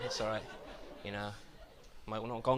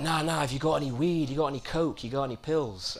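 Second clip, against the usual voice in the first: higher-pitched, insistent mock questioning; lightly voiced punter pressing for drugs; pestering partygoer; 5 semitones higher than usual. Partygoer pestering supposed drug dealer.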